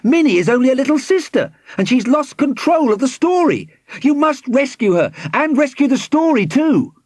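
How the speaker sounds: high, urgent pleading; distressed cry. A narrator's reading. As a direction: beg urgently for a rescue. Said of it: "cried Formby".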